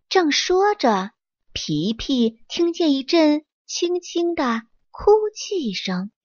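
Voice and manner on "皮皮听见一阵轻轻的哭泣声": ordinary delivery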